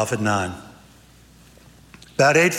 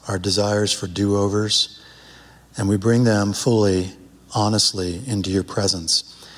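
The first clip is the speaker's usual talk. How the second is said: prayer; 6 semitones lower than usual. Acted. Opens with prayer.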